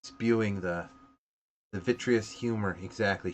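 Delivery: ordinary delivery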